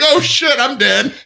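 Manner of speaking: high, falling cry of doom; mock dread. Recruit realizing he's been grabbed, high mock dread. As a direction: play the caught recruit, pitch jumping up.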